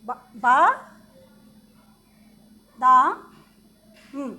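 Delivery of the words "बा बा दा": बा- बा- दा- spelled by syllable, louder; syllables split out. Shown as a demonstration. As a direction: break बादाम into slow syllables. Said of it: "बा का डंडा चढ़ेगा".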